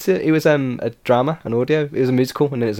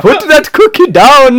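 high, loud imitation of the Indian-accent shout; teasing copy of the accented shout; mock Indian accent; 9 semitones higher, 6 dB louder, slower than usual. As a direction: mimic the bad accented yell. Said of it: "do that accent when you shout at me".

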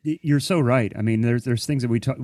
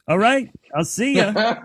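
breezy, higher goodbye, a bit louder; 10 semitones higher, 4 dB louder than usual. Retold or imitated. Shooed off the stage, he answers with a breezy higher goodbye. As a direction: toss it off lightly, going up in pitch. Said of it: "And I'm like".

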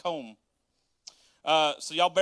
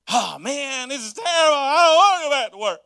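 higher and louder, hammed-up crying shout; mock wail; 7 semitones higher, 9 dB louder than usual. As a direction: wail it like a kid who must go home. Dreading going back to work.

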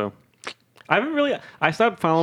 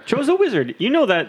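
excited high voice; 11 semitones higher than usual. Pretend. Excited teasing.

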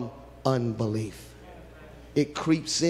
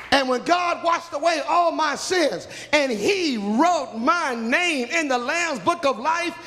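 preaching at full force; 12 semitones higher than usual. Real, not acted. Preacher to congregation, shouting praise.

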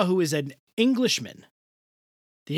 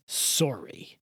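"Sorry" said softly, Canadian style; hushed, mock Canadian "Sorry"; a quiet imitation of a Canadian "Sorry"; 4 semitones lower, 6 dB quieter than usual. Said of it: "he says".

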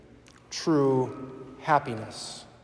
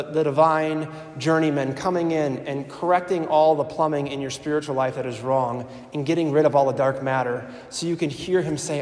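ordinary delivery

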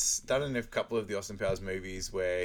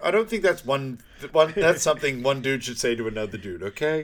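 loud, higher-pitched mock rebuke; emphatic objection; 4 semitones higher, 9 dB louder than usual. Telling Goldmember off in a film retelling, loud mock rebuke. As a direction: object loudly in character.

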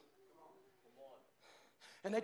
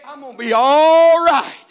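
much louder and higher, slowed; preacher's shout; 6 semitones higher, 21 dB louder, slower than usual. Acted. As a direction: burst it out to the congregation. Shouted repeat of quiet line.

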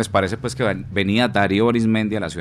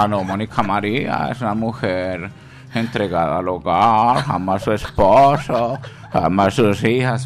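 mother-in-law voice; slower than usual. Retold or imitated. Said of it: "Y la suegra".

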